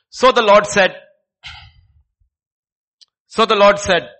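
very loud, booming proclamation; thundering declaration. Acted. Preacher voicing God's judgment on man, booming loud. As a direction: boom it out like a verdict.

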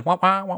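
high wah-wah door noise; whiny door sound. Retold or imitated. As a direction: whine like a sliding door. Imitating shuttle bay doors again.

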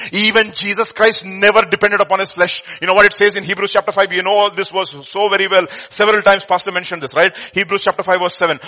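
ordinary talk, nothing marked

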